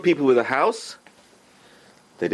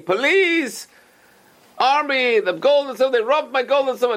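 pretend screaming; 13 semitones higher than usual. What you and I would yell if robbed, mock screaming. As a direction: call out for the police like a robbed man. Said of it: "would have started screaming".

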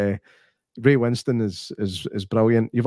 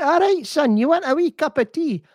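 high, hammed-up Glaswegian old woman; mock a Glasgow granny offering tea; old Glasgow woman accent. Imitating Emma Thompson's Glasgow character offering a wee cup of tea. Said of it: "Her Scottish accent in that film".

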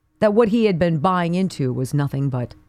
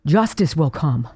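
normal talking voice

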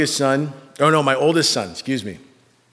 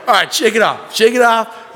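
loud, raised-pitch self-urging; mock self pep talk; 8 semitones higher, 6 dB louder than usual. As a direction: psych yourself up after crying. Father giving himself pep talk.

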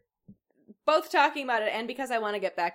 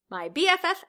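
perky and proud; 8 semitones higher, 6 dB louder than usual. Acted. Janet proudly naming friend.

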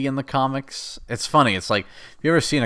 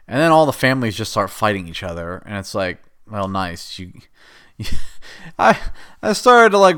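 ordinary delivery

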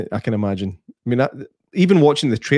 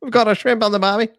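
higher hammed-up Australian accent; tossing out an Aussie cliche in an Australian accent; mock Australian accent; 7 semitones higher than usual. Answering the English joke, mock Australian accent. Jokey Australian impression reply.

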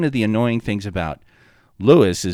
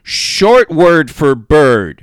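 mock slow clue; 6 semitones higher, 9 dB louder, much slower than usual. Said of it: "and he's like".

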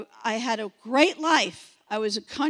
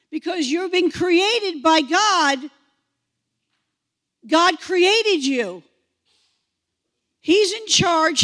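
loud, high, impassioned preaching; preacher at full force; emphatic preaching; 4 semitones higher, 4 dB louder than usual. Real, not acted. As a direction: drive each phrase home forcefully. Preacher driving the point home.

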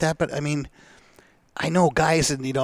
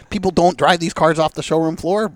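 usual speaking voice

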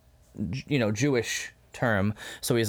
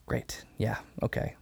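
resigned mutter; 3 semitones lower, 7 dB quieter than usual. Retold or imitated. Jesus resigned, voiced softly.

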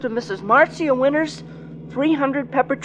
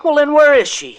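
raised, pleading demand; anxious outburst; 4 semitones higher, 7 dB louder than usual. Acted. Sonny pressing his boss about his missing sister, in a louder anxious demand. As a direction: push back hard, worried for his sister. Worried boy demands answers.

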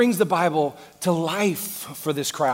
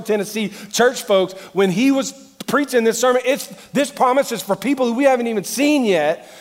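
loud, higher-pitched pulpit preaching; raised voice, driving the point home; full-force preaching; 7 semitones higher, 9 dB louder than usual. Acted. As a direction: preach it hard to a big congregation.

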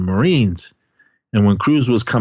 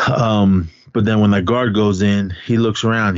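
usual speaking voice

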